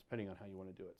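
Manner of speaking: ordinary talk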